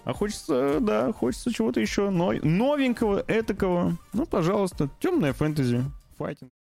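high, begging whine; pleading. Real, not acted. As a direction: beg for it in a high plaintive voice. Pleading for something new.

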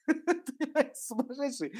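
laughing through the word, high. Real, not acted. Teacher laughing at her own joke to students, laughing. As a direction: giggle it out.

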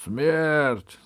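loud, high cry for blood; shouting courtiers. A narrator's reading. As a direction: call it out like a crowd demanding execution. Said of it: "закричали вельможи".

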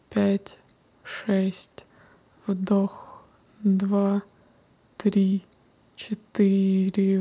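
slow whispered counting; slow breath counting. Acted.

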